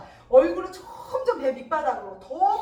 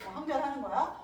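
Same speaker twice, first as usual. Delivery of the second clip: ordinary talk, nothing marked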